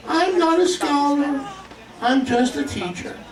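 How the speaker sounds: high, slow mock-meek voice; false humility. Preacher mocking a modest colleague, high mock-meek voice. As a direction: parody a falsely humble preacher. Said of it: "showing false humility".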